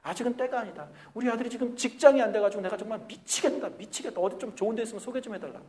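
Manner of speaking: a parent grumbling about a jobless son; complaining parent.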